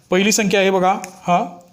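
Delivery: ordinary talk, nothing marked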